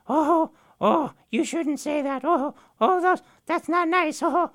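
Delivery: pretend-scandalised gossip; scolding fake outrage in a high voice. Raven pretends to listen.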